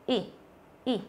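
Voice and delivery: "y" as a quick, short ih. Shown as a demonstration. Teacher showing students the short vowel, "y" clipped quick. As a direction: cut "y" short with one push of the jaw. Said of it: "Mình đẩy cái hàm dưới một phát".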